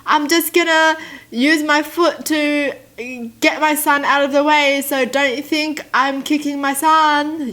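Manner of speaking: called out loud, high and slow; mock announcement. A parent announcing to the room, a loud slow mock call. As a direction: spell it out loudly like a public notice. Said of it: "they were shouting out".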